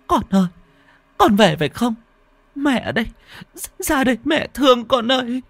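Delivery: put-on high, eager calling to her child; pleading call. Mother rushing to her child's ghost, calling high. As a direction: reach out with a high, loving cry.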